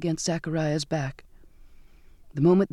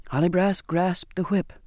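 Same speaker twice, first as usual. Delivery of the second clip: ordinary delivery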